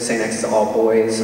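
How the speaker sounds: ordinary delivery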